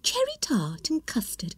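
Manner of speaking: Alice, breathless with delight, whispering; excited little girl whispering. Acted. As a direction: whisper each tasty flavour in wonder. Alice savours the drink.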